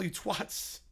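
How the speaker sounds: usual speaking voice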